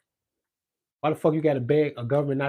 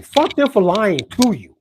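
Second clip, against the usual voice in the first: angry outburst; 7 semitones higher, 9 dB louder, slower than usual. Real, not acted. Angry rant at government.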